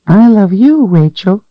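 very high, tiny doll voice. Acted. The doll speaks to Rachel.

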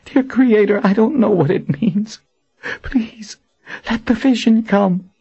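high, pleading desperate prayer. Acted. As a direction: pray for the vision in panic. Warren praying in desperation.

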